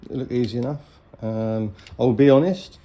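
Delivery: normal talking voice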